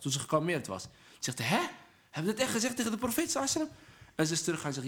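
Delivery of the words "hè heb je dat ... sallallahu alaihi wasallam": high, fast disbelief; acted-out incredulous question; astonished question. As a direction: ask it in rushed, high-pitched shock. Retold astonishment at her rudeness.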